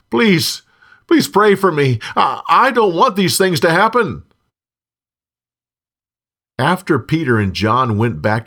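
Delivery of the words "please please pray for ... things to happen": high-pitched, urgent pleading; crying out in plea. A narrator's reading. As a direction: beg anxiously, voice raised in pitch. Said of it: "Simon cried out".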